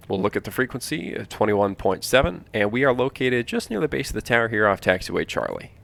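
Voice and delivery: normal talking voice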